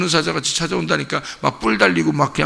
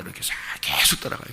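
stalking whisper; 6 semitones lower, 7 dB quieter, faster than usual. Acted. Lion silently stalking its prey.

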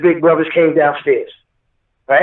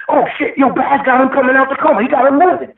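excited sister; 6 semitones higher than usual. Retold or imitated. Sister sees him move in coma.

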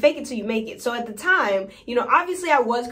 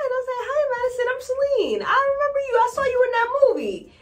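high excited fangirl; 13 semitones higher than usual. Retold or imitated. Excited celebrity encounter retold.

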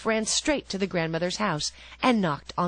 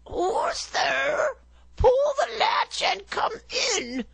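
very high, strained fake granny voice; the wolf in bed faking granny's voice; slower than usual. A narrator's reading. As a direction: squeak like a wolf pretending to be granny. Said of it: "changing his voice to match her grandmother's".

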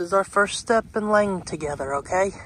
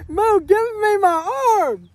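higher, louder, slow pleading call; laughing plea; 10 semitones higher, 6 dB louder, slower than usual. Real, not acted. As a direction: beg the cow, laughing and stretched out. Arm pinned under cow, pleading.

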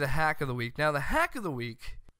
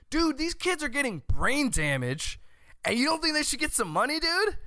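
exasperated outburst; 11 semitones higher, 4 dB louder than usual. Real, not acted. Exasperated rant at Tim Tebow.

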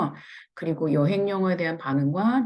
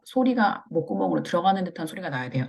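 ordinary talk, nothing marked

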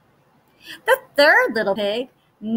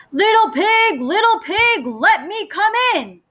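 loud, higher wolf call; 8 semitones higher, 4 dB louder than usual. Acted. Wolf at the door.

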